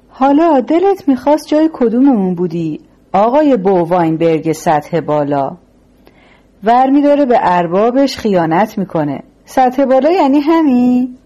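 sarcastic, worked-up boss to his betrayer; taunting gangster boss. A narrator's reading. Boss sneers at captive traitor.

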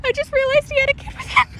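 talking through high giggles; can barely get words out for laughing; laughing fit. Real, not acted. Giggling fit over passerby.

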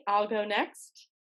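ordinary talk

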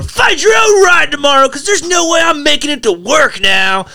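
high, loud shout; retold angry shout leaving the house. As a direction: yell it walking out the door. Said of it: "I'm just pissed".

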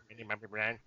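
low, soft mumbled name; teasing impression of a besotted friend; mock friend's answer. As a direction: drop low and quiet for the punchline.

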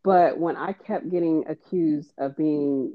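ordinary delivery

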